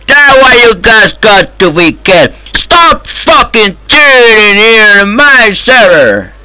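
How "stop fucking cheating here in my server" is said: normal talking voice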